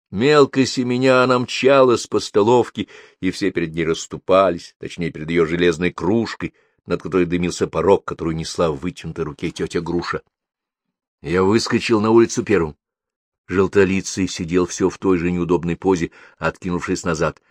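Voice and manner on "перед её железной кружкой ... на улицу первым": ordinary delivery, nothing marked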